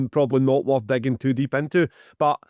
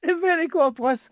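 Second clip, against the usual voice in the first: relieved recognition; 14 semitones higher than usual. Real, not acted. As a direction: pounce on it with relief.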